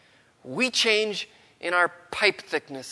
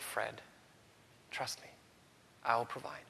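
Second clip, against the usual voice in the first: near-whispered, much softer reply; soft inner voice; 5 semitones lower, 15 dB quieter than usual. Retold or imitated. God's little voice answering Fred's fear, very soft. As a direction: hush it like a still small voice within. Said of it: "And a little voice said".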